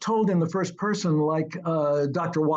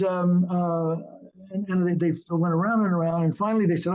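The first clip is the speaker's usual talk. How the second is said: usual speaking voice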